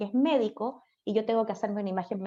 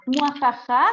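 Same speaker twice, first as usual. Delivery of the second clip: high, loud mock evil cackle; mock villain laugh; 4 semitones higher, 8 dB louder, slower than usual. Teacher reacting to a student's reminder, mock evil laugh. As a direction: burst into a playful villain's "mwahaha".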